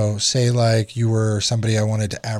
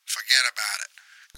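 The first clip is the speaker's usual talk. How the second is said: put-on softer New York accent, "Forget about it" with a falling close; a dismissive New York "Forget about it"; 4 dB quieter than usual. Clerk hangs up on the customer with a New York "Forget about it". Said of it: "New York accent would go".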